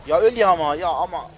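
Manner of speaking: loud, high desperate shout; exasperated yell. Real, not acted. Gamer screaming at an enemy who won't die, loud and high.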